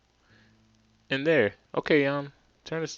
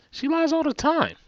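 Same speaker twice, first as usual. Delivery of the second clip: indignant retort; 13 semitones higher than usual. Acted. Sister defending brother.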